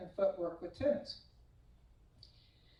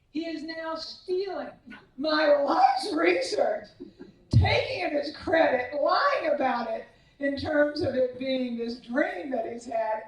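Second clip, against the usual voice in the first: indignant outburst; 6 semitones higher, 6 dB louder than usual. Real, not acted. Indignant at stolen research.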